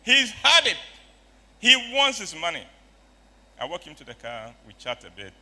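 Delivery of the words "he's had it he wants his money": loud, high exasperation; fed-up anger. Retold or imitated. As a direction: burst out at the end of patience. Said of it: "the anger is still there".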